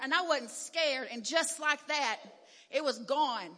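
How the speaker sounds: ordinary talk, nothing marked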